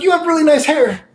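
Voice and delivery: high imitated lady voice; mock old lady. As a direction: pitch up to play her. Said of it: "she's like".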